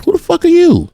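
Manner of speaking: high, heated challenge to the cop; a mock confrontation, pitch way up; angry retort. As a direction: square up and shout him down.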